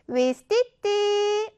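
위스티띠 drawn out long and high; 사진 찍을 때 외치는 말. Shown as a demonstration. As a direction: call a slow photo-taking "위스티띠" with a held last vowel. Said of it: "입이 예쁘게 벌어지는지 한번 해볼까요?". (사진 찍듯 길게 끌며 "위스티띠")

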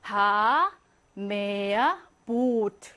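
"하, 메야, 보트" with each double vowel held long; one long held vowel per word in "하, 메야, 보트". Shown as a demonstration. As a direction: stretch the vowel in "하", "메야" and "보트". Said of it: "길게 장음으로 읽어야".

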